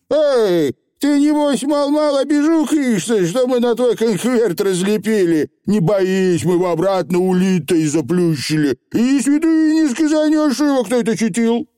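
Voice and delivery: high, chattering dialect; breezy little rogue brushing it off; cheeky Feegle chieftain. A narrator's reading. Feegle reassuring an offended girl, high and cheeky.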